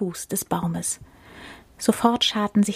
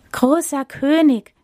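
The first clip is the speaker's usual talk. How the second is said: gazelle voice; 7 semitones higher, 3 dB louder than usual. Acted. Gazelle addresses lion king.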